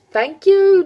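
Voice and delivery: high, loud questioning "thank you"; unsure exclamation. Real, not acted. Guessing the meaning of arigato, high and unsure. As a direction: squeak it out unsure.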